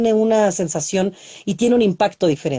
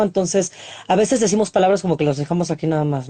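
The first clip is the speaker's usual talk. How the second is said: usual speaking voice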